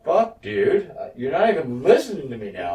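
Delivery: high, loud exasperated whine; mock frustrated outburst; hammed-up complaint; exasperated quote. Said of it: "and Quain's like".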